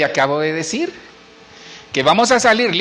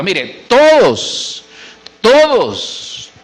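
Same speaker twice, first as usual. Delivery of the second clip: "todos" drawn out slow and punched loud; emphatic todos; 4 semitones higher, 5 dB louder, much slower than usual. Real, not acted. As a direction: lean hard on "todos", stretch it. Preacher hammers home "todos.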